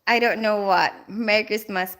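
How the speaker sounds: ordinary delivery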